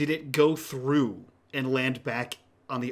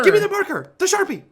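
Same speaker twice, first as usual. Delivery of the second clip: very high, loud urgent yell; shouting impatiently through a window; frantic demand; 9 dB louder, faster than usual. Acted. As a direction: demand it at full pitch. Mitch demands marker from cab.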